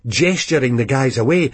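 ordinary delivery

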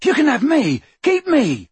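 loud, high, desperate plea; shout. A narrator's reading. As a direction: shout to be taken instead. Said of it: "shouted Ron".

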